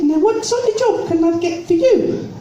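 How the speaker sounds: high, plummy posh lady voice; posh society lady greeting the jobseeker. Retold or imitated. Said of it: "She said".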